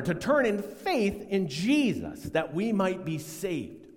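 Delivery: usual speaking voice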